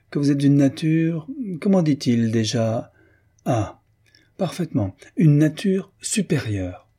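sweet-sour insinuation; half amiable half insolent. A narrator's reading. Baroness needling Chagny, half polite half insolent. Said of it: "à moitié aimable, à moitié insolente".

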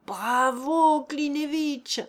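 loud shout of bravo; cheering crowd. A narrator's reading. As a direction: cheer him on like a rowdy crowd. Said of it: "hurlèrent les voix".

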